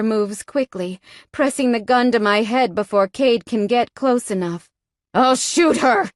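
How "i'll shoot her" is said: louder, higher threatening yell; yelled threat. A narrator's reading. Captor threatening to shoot the hostage, yelled. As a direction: shout the threat at him. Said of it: "Jasper yells".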